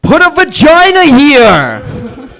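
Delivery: put-on brash New York accent shout; New Yorker impression. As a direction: belt it like a New Yorker on the street. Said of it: "New Yorker who's getting a sex change".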